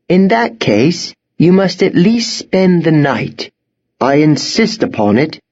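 high-pitched, firm headmistress; headmistress, insistent. A narrator's reading. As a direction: insist as the one in charge.

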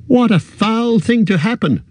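high, wild outburst; distressed hobbit crying out. A narrator's reading. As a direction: Frodo crying out wildly, pitched well up. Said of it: "cried Frodo wildly".

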